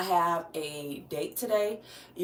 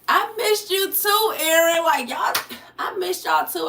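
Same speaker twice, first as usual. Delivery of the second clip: high, loud, gushing welcome; excited greeting; 10 semitones higher, 7 dB louder than usual. Real, not acted. Streamer tells returning viewers they were missed, excited and loud. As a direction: call it out high with the words drawn out.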